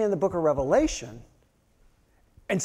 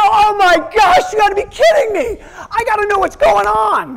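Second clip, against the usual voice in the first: high, loud exasperated wail; frustrated outcry; 11 dB louder than usual. Retold or imitated. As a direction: cry out at the cliffhanger, much higher and louder. Viewer shocked by cliffhanger.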